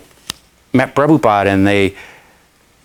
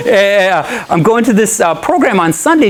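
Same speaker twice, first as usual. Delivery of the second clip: eager friend; 8 semitones higher than usual. Retold or imitated. Friend's invitation continues.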